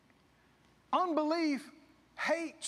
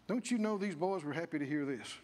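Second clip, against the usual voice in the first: usual speaking voice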